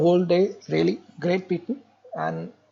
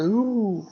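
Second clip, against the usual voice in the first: a short breathy animal snarl; soft mock animal snarl; demo snarl; 3 semitones higher, 4 dB quieter than usual. Shown as a demonstration. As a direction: snarl like a wolf at someone. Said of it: "they always snarl like this".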